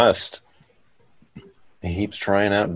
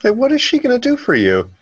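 incredulous; 13 semitones higher, 4 dB louder, faster than usual. Real, not acted. Incredulous mocking comment.

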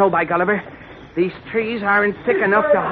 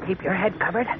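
soft urgent hiding voice; hushed; 5 dB quieter than usual. Acted.

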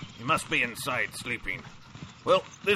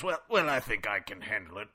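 normal talking voice

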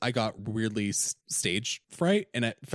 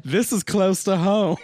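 high, loud laughing aside; laughing joke; 9 semitones higher, 8 dB louder than usual. Retold or imitated. Joking about the breakdown role while laughing. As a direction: laugh through the line. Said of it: "And I'm like".

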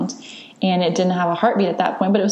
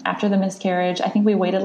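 ordinary talk

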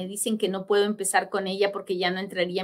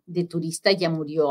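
normal talking voice

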